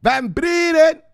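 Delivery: high, slow, loud calling mama voice; mother calling. Pretend. Mother calling her son down from the basement, high and slow.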